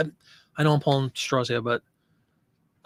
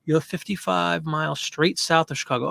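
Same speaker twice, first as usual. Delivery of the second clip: usual speaking voice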